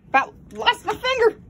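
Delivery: high, loud startled complaint; shocked loud complaint. Real, not acted. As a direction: blurt it out, still stinging. Just bitten by pet.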